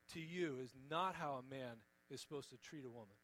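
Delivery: ordinary talk, nothing marked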